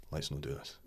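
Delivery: a low, soft warning; soft aside. Retold or imitated. To agent Biscuits in the meeting, soft low aside. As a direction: murmur it to your agent.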